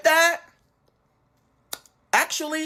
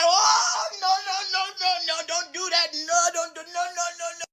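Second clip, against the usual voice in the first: high wailing string of no's; frantic, high-pitched pleading; panicked protest; 9 semitones higher than usual. Retold or imitated. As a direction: imitate a crowd in alarm. Everybody tries to stop the fight.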